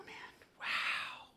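real whispered amazement; awed whisper. Real, not acted. Man overwhelmed by his blessings, whispering in awe. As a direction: let it out as a stunned whisper.

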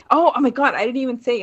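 high, loud burst of remembering; real excited realisation, pitch jumping up; sudden realisation. Real, not acted. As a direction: catch yourself having skipped the best part. Suddenly remembers a forgotten scene.

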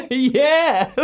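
high laughing yeah; a high yeah through laughter. Retold or imitated. Mate's retold answer, laughing.

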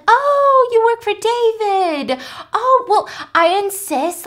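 sugary wife who won't take no; high sweet insistence. Retold or imitated. Adele gushes and insists.

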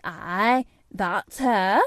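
lightly broad Yorkshire woman; a touch of Yorkshire; Yorkshire accent. A narrator's reading. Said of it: "speaking with a Yorkshire accent herself".

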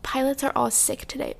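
a touch of a sarcastic, prissy airline voice; mocking the airline. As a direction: lightly parody the official excuse. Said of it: "they were like".

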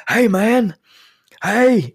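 loud, high holler down the phone; imitated yelling. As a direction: yell as if unaware of being loud. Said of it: "but he was yelling".

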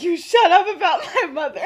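loud, high mock threat, half laughing; pretend fury. Sirius pretending to attack his friend over his mother, mock fury. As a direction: go at them in hammed-up anger. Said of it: "pretends to go at James".

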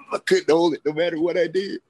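high, strained mock breath-holding; imitated breath-holding. Storyteller showing friends failing to hold a hit, mock strain. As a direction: act out struggling to hold it in. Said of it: "I sit there holding it".

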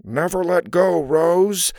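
playing the man's part; side-of-mouth voice. A narrator's reading. Girl switching to Jack's part in Titanic, side-of-mouth voice. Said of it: "in a lower voice out of the side of her mouth".

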